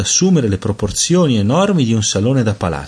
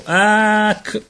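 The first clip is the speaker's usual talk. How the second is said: much higher delighted "ac"; baby exclamation; 13 semitones higher than usual. A narrator's reading. Peter pointing at the light patterns, high baby exclamation.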